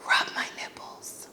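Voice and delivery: breathy secret whisper in her ear; mock creepy whisper. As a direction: whisper it like a sleazy come-on. Creepy date whispers request.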